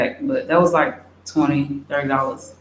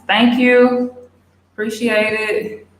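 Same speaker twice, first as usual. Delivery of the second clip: delighted thanks; 6 semitones higher, slower than usual. Real, not acted. Viewer compliments her hair.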